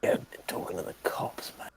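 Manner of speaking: soft unvoiced whisper of the quote; whispered movie line. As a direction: hush the line out. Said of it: "can you say the line again".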